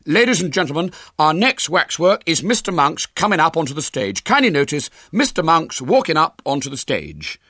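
high, loud showman's patter; big, high-pitched ladies-and-gentlemen voice; young showman William. Acted. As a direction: announce it proudly like a fairground barker. William presents next waxwork.

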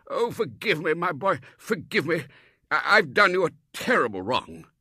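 put-on high, loud, pleading old Colonel; an old soldier begging pardon; remorseful Colonel. Colonel begging Robert's forgiveness, high and loud.

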